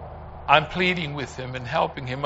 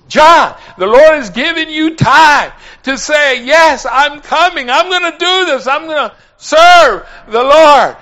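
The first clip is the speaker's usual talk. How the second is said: high, loud urging; fervent retold plea, nearly shouted; impassioned pleading; 10 semitones higher, 12 dB louder than usual. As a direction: exhort him at full voice. Retold pleading with dying man.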